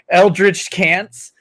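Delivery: ordinary delivery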